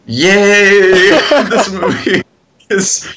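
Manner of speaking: high, drawn-out ironic yay; mock cheer. Film fan joking about a horrible movie, mock cheer. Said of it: "I'm cheering 'cause my heart dropped".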